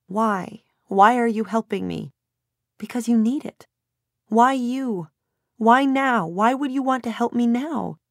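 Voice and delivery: high, upset questioning; distressed, incredulous plea; tearful demand. A narrator's reading. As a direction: press her for answers through tears. Crying daughter challenges her mother's help.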